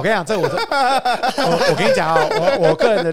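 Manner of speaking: 边笑边说，笑得停不下来; laughing. Real, not acted. Laughing at the question. (忍不住笑着说)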